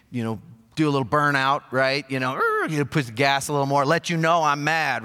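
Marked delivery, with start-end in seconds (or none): 2.3-2.7 short high-pitched screech noise; imitated tire screech. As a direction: mimic tires squealing on a burnout. Mimics tires in a burnout.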